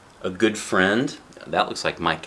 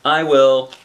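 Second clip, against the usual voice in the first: put-on higher, loud kid's answer; child replying; 6 semitones higher, 10 dB louder than usual. Boy answers his parent about the suit, loud child voice. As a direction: call it back in a child's voice.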